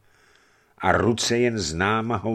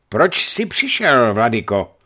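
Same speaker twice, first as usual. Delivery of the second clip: menacing call; 4 semitones higher, 8 dB louder than usual. A narrator's reading. Giant warrior challenges the bishop, menacing loud call. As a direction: bellow it at the bishop as a threat. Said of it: "volal hrozivě".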